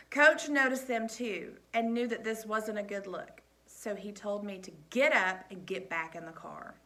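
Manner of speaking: ordinary delivery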